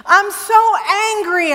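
retold flash of fury at a traffic stop; angry outburst. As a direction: blurt out the anger at the officer. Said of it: "I said to him".

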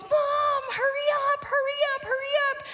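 high, insistent repeated urging; really anxious child. Retold or imitated. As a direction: imitate a frantic kid rushing mom. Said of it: "really anxious".

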